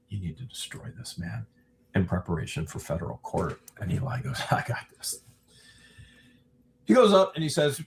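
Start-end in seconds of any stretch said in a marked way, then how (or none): 0.1-3.6 hushed, quick confidential aside; retold whisper. Whispering to Eli before his cross-examination, retold hushed urging. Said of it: "And I whispered to Eli beforehand". 4.5-5.2 unvoiced whisper, very brief; retold whispered reply. Eli answering a whispered urging before court, hushed. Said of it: "I whispered to Eli beforehand".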